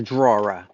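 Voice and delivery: 'drawer' stretched to two syllables, draw-ruh; over-sound 'drawer' as DRAW-ruh; 'drawer' with an R and an extra vowel; mock wrong pronunciation. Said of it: "teach you how to pronounce things".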